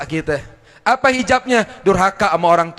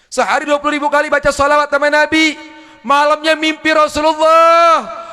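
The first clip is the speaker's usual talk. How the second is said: higher and louder with delight; excited, joyful; 8 semitones higher, 5 dB louder than usual. Retold or imitated. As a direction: tell it with rising joy. Joyful boy meets Prophet. (gembira dan bersemangat, suara tinggi)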